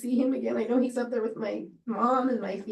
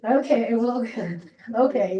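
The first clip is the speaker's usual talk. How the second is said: laughing reply; 3 semitones higher, 8 dB louder than usual. Retold or imitated. Her reply in ice cream story.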